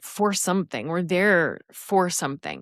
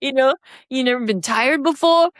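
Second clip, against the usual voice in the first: put-on incredulous Boston-accented pep talk; 4 semitones higher than usual. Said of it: "in a Boston accent in my head".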